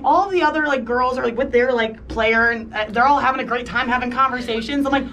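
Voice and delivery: ordinary talk, nothing marked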